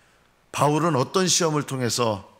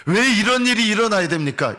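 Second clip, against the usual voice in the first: higher, louder indignant protest; complaining to God; 8 semitones higher, 5 dB louder than usual. Retold or imitated. Preacher acting out a believer protesting his trial, indignant. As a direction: demand an answer from God, offended. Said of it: "이런 말 하지 마십시오".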